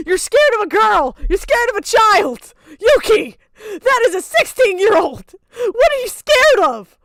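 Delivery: high, loud incredulous mockery; mocking disbelief. Real, not acted. Laughing at a grown friend scared of a child. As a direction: ridicule her fear.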